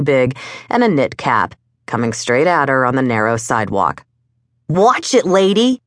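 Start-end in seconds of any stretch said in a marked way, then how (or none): 4.7-5.8 put-on kid's higher-pitched warning yell; mild yell. A narrator's reading. Kid on a bike to woman in his path, mild yell. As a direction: call out a mild, high warning. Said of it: "he yelled".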